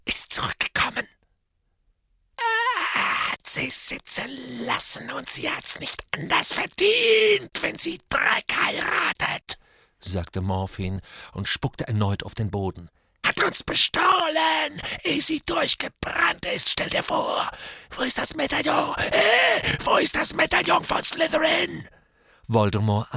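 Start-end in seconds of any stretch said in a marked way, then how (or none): 0.1-9.6 high, cracked mad voice; a deranged drunken hermit; crazed drunk. Acted. Morphin's voice. 13.3-21.9 high, cracked mad voice; a deranged drunken hermit; crazed drunk. Acted. Morphin's voice.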